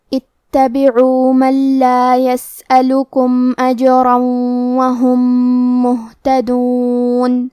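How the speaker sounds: slow, drawn-out melodic recitation; Quran recitation. Acted. Teacher recites the passage to students, melodic tajweed.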